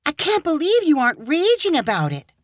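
be angry on his behalf; indignant Hannah. Acted. Hannah outraged at Caleb's landlord, indignant.

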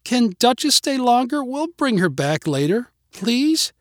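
put-on high little-girl plea; pleading child. A narrator's reading. Girl begging neighbors to leave their dog, high pleading.

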